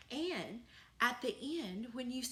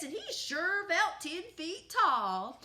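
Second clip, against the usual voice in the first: ordinary delivery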